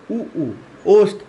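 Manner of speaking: उ उ slow and rounded on the lips; होंठ गोल करके धीरे; lip vowels. Shown as a demonstration. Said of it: "उ उ करेंगे तो ओष्ठ".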